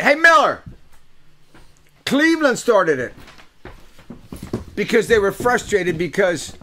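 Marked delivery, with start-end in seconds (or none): 0.0-3.1 loud callout. Pretend. Yelling back at friend Miller, loud and high.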